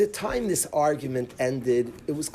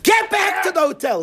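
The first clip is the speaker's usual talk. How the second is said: explosive high yell, much louder; fierce shout; 5 dB louder than usual. Retold or imitated. Rabbi exploding at Tzvi to go back, furious shout. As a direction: blow up at him to get back. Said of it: "my Rebbe gets fierce and is upset".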